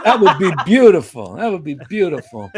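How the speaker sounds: high, loud and thrilled, laughing through it; laughing, high-pitched delight. Retold or imitated. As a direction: gush with joy at the offer. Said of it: "I said".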